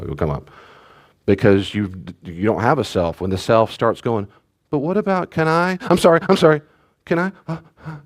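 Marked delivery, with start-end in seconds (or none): 4.7-8.0 put-on high, rushed pleading child voice, apologizing; anxious child pleading. A child begging the parent for permission, high and pleading. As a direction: plead like a scared little kid.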